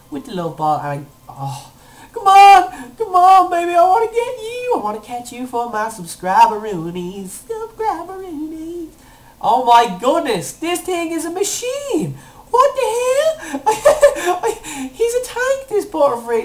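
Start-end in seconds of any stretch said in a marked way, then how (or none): 2.1-4.7 high, loud plea to the game; pleading excitement; desperate urging. Real, not acted. Begging the ball to catch. 7.5-8.9 high, drawn-out silly echo; playful high-pitched repeat; silly squeal. Pretend. Playful repeat of coined word. 9.4-16.4 slow, high exclamation breaking into laughter; incredulous, pitched way up; laughing disbelief. Real, not acted. Amazed that the catch fails.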